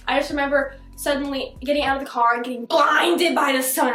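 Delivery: ordinary delivery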